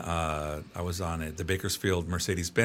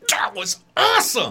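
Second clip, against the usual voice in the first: excited; 10 semitones higher, 5 dB louder than usual. Retold or imitated. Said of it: "the groom would always turn to me".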